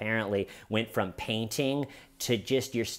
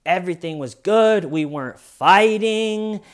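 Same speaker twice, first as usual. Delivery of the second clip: higher, louder chirpy mock-innocent voice; imitating Sarah; 5 semitones higher, 6 dB louder than usual. Mocking her story to detectives in a higher, louder chirpy voice. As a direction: sell the cheery bluff, pitched up. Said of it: "she paints that".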